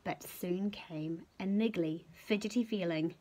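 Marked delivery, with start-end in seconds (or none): none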